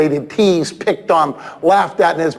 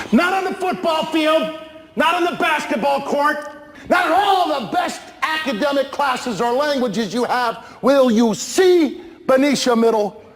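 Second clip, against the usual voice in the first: higher, urgent proclaiming; rally the room; praising a brave student to the school; rousing shout; 8 semitones higher than usual. Real, not acted. Speaker praising courage.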